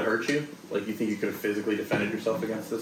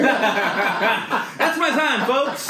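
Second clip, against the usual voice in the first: laughing exit line; 12 semitones higher, 3 dB louder, much slower than usual. Acted.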